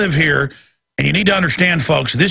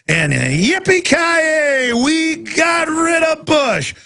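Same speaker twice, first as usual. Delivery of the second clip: much higher, whooping giddy fan voice; mock Obama fan; 12 semitones higher, slower than usual. Mocking Obama voters celebrating Bush leaving, high giddy whooping. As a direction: ham up a gleeful cheering voter.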